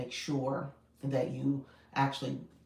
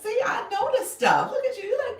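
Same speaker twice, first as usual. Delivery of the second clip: excited squeal; 8 dB louder than usual. Real, not acted. Excited reaction to viewer's comment.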